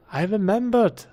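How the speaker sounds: proud and gleeful; excited. Real, not acted. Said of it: "repeats it with more energy".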